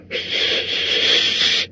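unvoiced, soft hissing shush; upset hiss. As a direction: hiss in frustration. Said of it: "said Gollum, more upset than ever".

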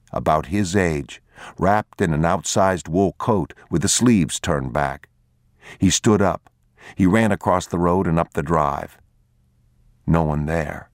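usual speaking voice

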